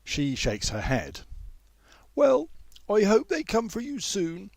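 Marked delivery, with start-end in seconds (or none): 2.1-4.5 put-on high, bright well-wishing older woman; older woman, friendly. A narrator's reading. Older woman wishing the young man well, high and friendly. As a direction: wish him well in a lifted, kindly voice. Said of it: "smiling more easily once again".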